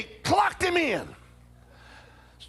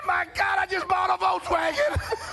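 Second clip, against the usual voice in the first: higher, laughing cry of disbelief; shocked outburst; 7 semitones higher than usual. Retold or imitated. Brother realizing he may have to buy the car.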